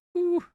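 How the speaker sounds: a sharp, high, loud whew. Real, not acted. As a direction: blow out a stunned high whew. Overwhelmed by the finale.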